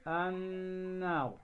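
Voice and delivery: النار with a drawn-out nasal hum on the n; ghunna on النار. Shown as a demonstration. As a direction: hold the doubled n in النار, long and steady. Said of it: "أن نظهر الغنة بمقدار حركتين". (إظهار الغنة في نون النار بمقدار حركتين)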